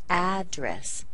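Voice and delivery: "address" with a strong open AD; first-syllable stress. Shown as a demonstration. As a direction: punch the first syllable of "address", let dress fall. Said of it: "ah is the strongest syllable".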